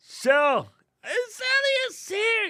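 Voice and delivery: high-pitched, excited call-out; excited greeting. Real, not acted. Host spots Shelly arriving in the chat, excited greeting. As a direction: announce an arrival in a delighted high cry.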